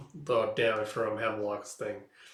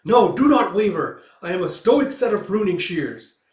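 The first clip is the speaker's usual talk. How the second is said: higher, louder self-command; stern resolve; 7 semitones higher, 9 dB louder than usual. Acted. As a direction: steel yourself again, much louder. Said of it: "repeats his resolve".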